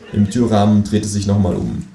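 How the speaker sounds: ordinary delivery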